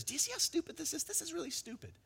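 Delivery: very high, hushed little voice; author's imagined aside. Pretend. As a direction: slip it in as a tiny falsetto aside. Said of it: "author adds his own voice to the mix".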